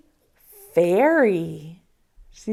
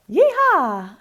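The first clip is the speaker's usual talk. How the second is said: high cowgirl whoop; cowgirl yeehaw; 6 semitones higher than usual. Pretend. Teacher playing a cowgirl for the kids, high whoop.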